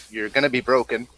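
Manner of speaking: ordinary talk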